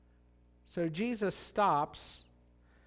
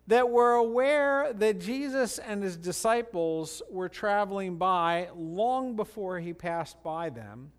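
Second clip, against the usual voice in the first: ordinary delivery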